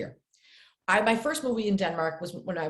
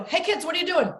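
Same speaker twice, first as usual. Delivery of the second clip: put-on high, bright call out to kids; friendly call to kids; 9 semitones higher than usual. Calling to a group of kids, high friendly call. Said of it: "I go".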